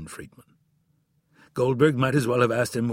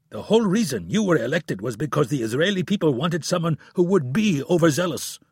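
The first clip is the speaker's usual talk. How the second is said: angry; 6 semitones higher, 4 dB louder than usual. A narrator's reading. Heated quarrel between leaders.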